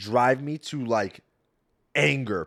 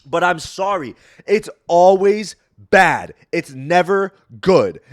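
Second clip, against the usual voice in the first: loud rant; 7 semitones higher, 12 dB louder, slower than usual. Real, not acted. Continuing loud coffee rant.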